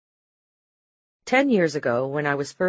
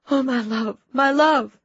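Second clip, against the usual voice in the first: higher, rising grief-stricken cry; anguished; 9 semitones higher, 4 dB louder than usual. A narrator's reading. Wife cries over her dying husband, high and anguished. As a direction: plead through rising grief.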